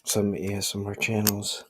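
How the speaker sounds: ordinary talk, nothing marked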